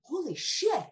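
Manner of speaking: high exclaim of sudden insight; mock realization. Guest voicing her own realization, excited. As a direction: gasp it out like a lightbulb moment. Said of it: "I'm like".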